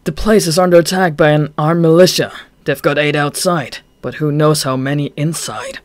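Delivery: urgent briefing on the attack; voiced Chuya.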